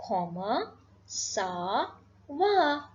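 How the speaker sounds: "Comment ça va" very slow, each word separate; drawn out "Comment ... ça ... va" with pauses; word-by-word demonstration. Shown as a demonstration. Teacher introducing the informal French greeting, word-by-word demonstration. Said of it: "we will say".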